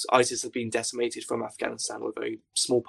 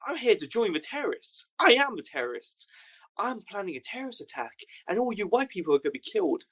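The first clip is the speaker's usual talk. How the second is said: mock a bragging jihadi, pitched way up; hammed-up angry recruit voice; imitating the American jihadi; 8 semitones higher than usual. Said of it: "And he goes".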